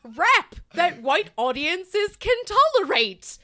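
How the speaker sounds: high, loud, slow mock showman; hammed-up explaining to children, words stretched out. Lamplighter explaining rap to the children, mocking showy voice. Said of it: "[name], and it's like".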